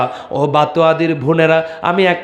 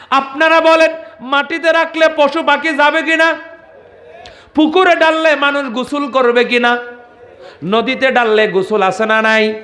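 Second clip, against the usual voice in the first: preaching at full force; 9 semitones higher than usual. Acted. Preacher telling a saint's story to the crowd, at full force. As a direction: call it out to the crowd like a village waz.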